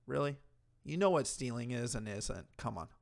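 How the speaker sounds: ordinary talk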